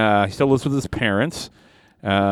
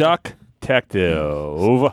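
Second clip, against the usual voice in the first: slow dramatic title; 3 semitones lower, much slower than usual. Acted.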